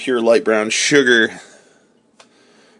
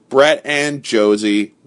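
usual speaking voice

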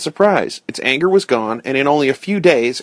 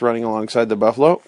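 plain reading voice

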